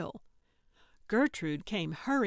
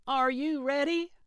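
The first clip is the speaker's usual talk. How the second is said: high, raised call from round a corner; 8 semitones higher, 5 dB louder than usual. A narrator's reading. Gertrude out of sight checking on Calvin, calling out. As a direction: call out loudly, voice lifting at the end. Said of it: "she called out".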